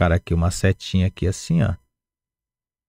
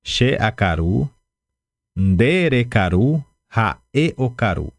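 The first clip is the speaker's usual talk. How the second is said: slow review drill; much slower than usual. Shown as a demonstration. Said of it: "Então, vamos lá".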